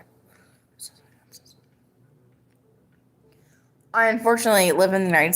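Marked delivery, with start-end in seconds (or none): 0.0-1.6 barely audible, voiceless whisper; whispered apology. Real, not acted. Streamer apologizing for the accent, whispering. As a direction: let the apology fade to nothing.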